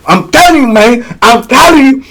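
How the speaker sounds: high, insistent imitated threat; mock-angry warning. Inmate warning youngins about his teeth, mock-angry.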